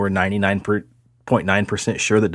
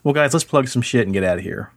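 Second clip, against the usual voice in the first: normal talking voice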